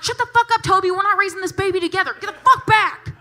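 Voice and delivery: loud, high furious yell; mock outraged scream; yelling at Toby. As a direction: blast the kid to back off. She yells at clerk.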